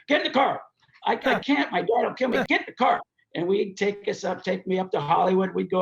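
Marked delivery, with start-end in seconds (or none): 0.1-0.6 higher-pitched mock command; insistent order. Evel ordering the teller along, acted insistent order. 1.1-1.7 acted protest. Teller acts own protest to Evel. 1.7-2.4 acted protest. Teller acts own protest to Evel. 2.5-3.0 higher-pitched mock command; insistent order. Evel ordering the teller along, acted insistent order.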